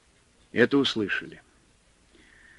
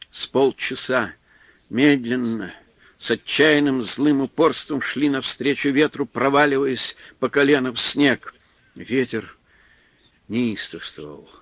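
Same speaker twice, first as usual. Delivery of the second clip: ordinary delivery, nothing marked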